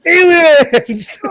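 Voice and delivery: whooping shout of laughter; excited shout. Real, not acted. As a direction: shout it out in delight. Shouting at absurd alien punchline.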